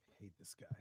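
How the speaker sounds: whispered aside. Real, not acted. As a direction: whisper it with disgust.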